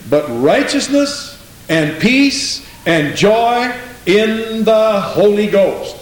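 ringing sermon delivery; preaching. Real, not acted. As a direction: preach it, stretching out the scripture. Preaches and cites scripture.

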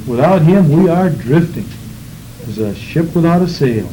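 lifted, pleading praise to the Lord; swelling preacher's prayer over music; fervent prayer. Acted.

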